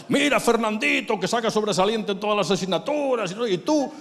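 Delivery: high and loud nagging father; imitating a scolding dad. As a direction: compare him to the neighbor, scornfully. Father comparing son to neighbor.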